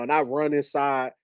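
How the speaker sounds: ordinary talk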